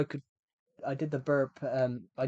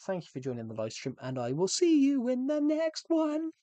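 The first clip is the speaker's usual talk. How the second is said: streamer sign-off voice; 9 semitones higher, 4 dB louder than usual. Pretend. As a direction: wrap it up like a cheesy streamer.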